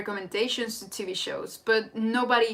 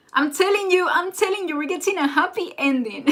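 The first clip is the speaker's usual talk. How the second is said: higher and louder, gleefully sure; excited insistence; 8 semitones higher, 6 dB louder than usual. Real, not acted. Insisting on a happy ending.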